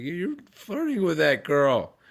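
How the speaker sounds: wife accusing husband at the rest stop, mock-accusing. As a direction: imitate the wife calling him out. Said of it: "And she's like".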